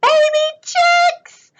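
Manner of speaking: very high, squeaky announcement; tiny chick voice. Acted. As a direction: introduce them in a teeny high voice. Introduces tiny chicks in story.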